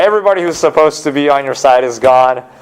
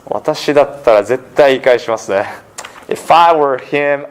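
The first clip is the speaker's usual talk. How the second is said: ordinary talk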